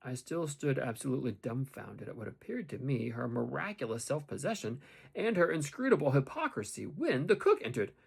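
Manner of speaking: ordinary delivery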